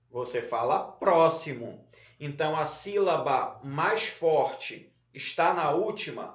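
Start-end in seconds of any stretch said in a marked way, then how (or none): 0.8-1.9 "próximo" with a strong first syllable, PRÓ-xi-mo; stress on PRÓ. Shown as a demonstration. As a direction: confirm "próximo" by leaning on PRÓ. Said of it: "No pró". ("próximo" com a primeira sílaba forte, PRÓ)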